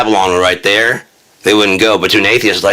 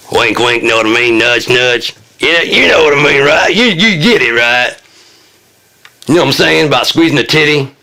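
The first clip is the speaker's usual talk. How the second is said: higher, sly nudging tone; leering innuendo; 6 semitones higher than usual. Acted. Nudging the audience about a dirty joke, sly leer. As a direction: lean in with a dirty wink.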